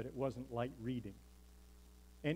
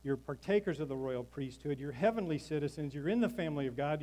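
ordinary delivery

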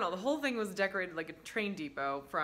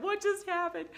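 put-on confused child; 12 semitones higher, 4 dB louder than usual.

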